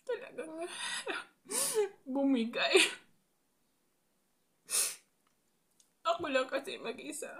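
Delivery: sob-laugh, high and breaking; laughing through tears; breaking down crying. Real, not acted. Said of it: "Naiyak ako".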